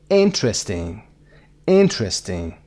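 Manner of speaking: "interesting" as IN-tresting, first beat strongest; stress on first syllable. Shown as a demonstration. Teacher showing word stress, first syllable of "interesting" stressed. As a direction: hit the start of "interesting", let it fall.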